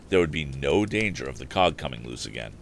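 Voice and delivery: ordinary delivery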